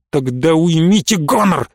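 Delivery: ordinary delivery, nothing marked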